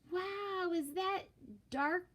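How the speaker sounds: put-on high, awed kid voice; amazed Clark. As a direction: gasp at a cool new toy. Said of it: "asked Clark".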